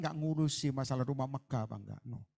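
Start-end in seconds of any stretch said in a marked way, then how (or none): none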